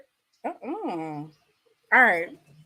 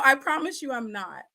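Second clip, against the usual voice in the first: ordinary talk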